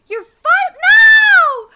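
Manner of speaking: high, loud cut-off cry; sudden shout. Acted. Character breaks off mid-line with a loud no. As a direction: snap out the no.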